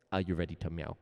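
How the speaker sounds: ordinary talk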